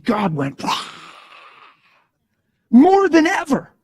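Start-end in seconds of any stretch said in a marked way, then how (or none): 2.7-3.7 high, loud and slow proclamation; booming answer. Retold or imitated. God answering the worried preacher, high and booming. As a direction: thunder it out as God's reply.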